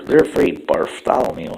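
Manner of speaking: usual speaking voice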